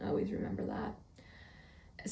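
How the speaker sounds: low, slow whisper; hushed solemn reminder. Pretend. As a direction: drop right down into a slow, whispered maxim. Mock-solemn aside after praising words.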